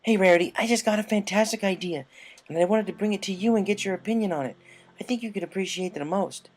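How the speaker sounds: eager, pitching an idea fast; Rainbow Dash voice. Acted. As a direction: Rainbow bursting in to pitch her idea to Rarity.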